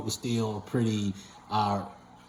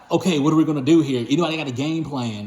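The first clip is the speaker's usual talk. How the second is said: louder, hurried mock panic; retold freak-out; 4 semitones higher, 5 dB louder, faster than usual. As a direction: re-enact rushing to find a plan, voice raised. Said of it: "So I'm freaking out".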